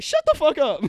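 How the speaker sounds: high, loud mock snap at the uncle; playful mock telling-off; aunt snapping. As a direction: bark it like an annoyed aunt. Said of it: "My aunt's like".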